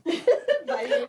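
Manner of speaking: short, high mock laughing burst; acted laughing. Patient laughing at the nurse, acted high laughter. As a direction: burst into high, put-on giggles. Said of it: "And then I'm like".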